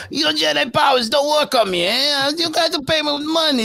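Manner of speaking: high, nasal-free put-on Watto voice; squeaky haggling shopkeeper impression.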